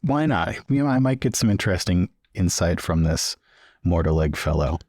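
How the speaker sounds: ordinary delivery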